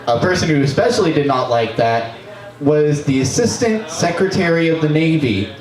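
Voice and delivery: ordinary talk, nothing marked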